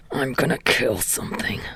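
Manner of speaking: low angry hiss. A narrator's reading. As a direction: hiss it through your teeth. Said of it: "Katsuki hissed".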